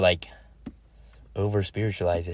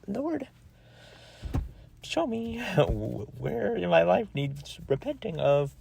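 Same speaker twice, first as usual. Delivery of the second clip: high, soft, drawn-out plea; hammed-up little prayer; mock-pleading prayer; 10 semitones higher, 5 dB quieter, slower than usual. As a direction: slowly beg in a small high voice. Acting out own prayer to God.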